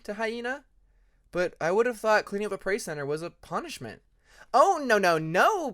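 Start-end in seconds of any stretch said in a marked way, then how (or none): 4.5-5.7 high, loud eager protest; quick cheerful no, no, no; eager correction. Acted. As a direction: brush off the idea brightly. Hyena eagerly correcting the others.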